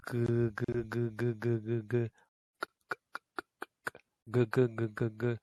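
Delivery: repeated g sounds, voiced and unvoiced; consonant drill. Shown as a demonstration. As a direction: drill the g back and forth. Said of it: "Try doing that for yourself".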